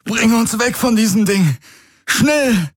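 hoch und drängend; drängender Befehl. A narrator's reading. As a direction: verzweifelt befehlen. Garland befiehlt in Not. (Garland befiehlt dem Schiff die Flucht)

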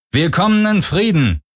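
high, projected announcement across the water; calling over loudspeaker. A narrator's reading. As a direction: call out as if through a loudspeaker. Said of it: "sagte eine männliche Stimme über Lautsprecher".

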